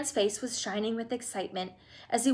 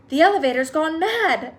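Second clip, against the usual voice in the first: high, loud alarmed cry; shouted; 6 semitones higher, 9 dB louder than usual. A narrator's reading. Grandpa Joe shouting at the runaway elevator, high loud alarm. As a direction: yell in alarm. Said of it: "shouted Grandpa Joe".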